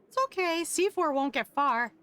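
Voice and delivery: calm the owner down kindly; lightly voiced cheerful girl; reassuring friend. Crystal reassures after robot escape.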